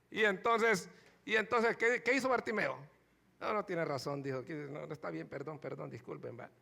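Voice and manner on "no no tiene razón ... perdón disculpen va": much softer, lower sheepish apology; fading, humble sorry; meek apology. Retold or imitated. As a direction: back down quietly, embarrassed. Beggar apologizing to scolding friends.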